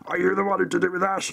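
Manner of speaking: high, silly put-on voice; mock Twitter user. Host mocking everyone on Twitter asking about Dan, high silly voice. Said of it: "everybody on Twitter speaks exactly like that".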